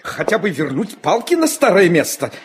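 ordinary talk, nothing marked

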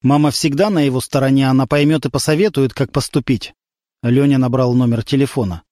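ordinary delivery, nothing marked

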